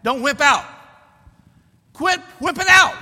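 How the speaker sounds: rising to a high, loud command; building preacher's shout; urgent exhortation. Real, not acted. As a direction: push the congregation harder each time. Preacher exhorting church against wimping out.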